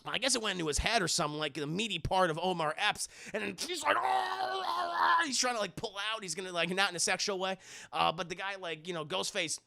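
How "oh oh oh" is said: high, drawn-out wail of pain; hammed-up howl of a stabbed victim; mock agonized cry.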